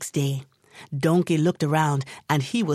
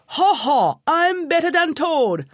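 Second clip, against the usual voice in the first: loud high braying boast; donkey bawling his win; bawled boast; 10 semitones higher, 4 dB louder than usual. A narrator's reading. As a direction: bray it out like a donkey crowing. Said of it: "he bawled out".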